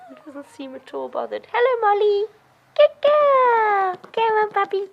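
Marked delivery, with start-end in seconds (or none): 1.5-4.9 loud, high sing-out to a dog; coaxing high dog-praise voice; high-pitched puppy call. Real, not acted. Calling dogs and puppy over.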